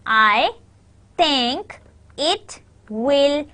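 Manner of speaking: very slow, one word at a time; slow stressed syllables. Shown as a demonstration. Teacher breaking down sentence stress, slow and word by word. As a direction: separate each word with a clear pause. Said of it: "So your stress is on".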